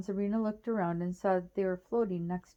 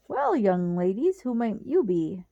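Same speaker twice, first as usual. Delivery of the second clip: charming Jack; 5 dB louder than usual. A narrator's reading. Jack greets the girls charmingly.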